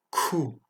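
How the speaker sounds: "ku" pronounced "kou" with a puff after K; breathy burst before the vowel in "ku"; aspirated K. Shown as a demonstration. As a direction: push air out on the K of "ku". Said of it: "mais expiré".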